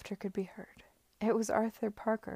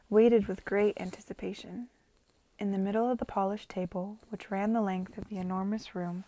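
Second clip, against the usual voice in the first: ordinary delivery, nothing marked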